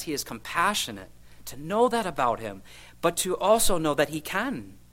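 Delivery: ordinary talk, nothing marked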